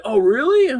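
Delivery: a high, loud "oh really?" put on as the kid; playing the kid's fake amazement; mock surprise. Kid feigns surprise at claim.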